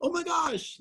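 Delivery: very high, fast gushing mother; excited mom. Retold or imitated. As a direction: squeal it like a thrilled mom. Mom voiced, growing excitement.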